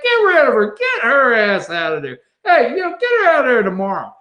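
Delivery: high, loud barked order; hamming up Trump yelling back; mock yell. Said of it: "He yells back".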